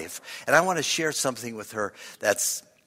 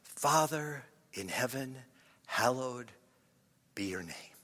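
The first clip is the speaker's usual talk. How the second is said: whispered prayer; 5 dB quieter than usual. Shown as a demonstration. Preacher modelling the Lord's Prayer, hushed fading voice. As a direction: let each phrase sink softly. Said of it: "Just whisper the first line".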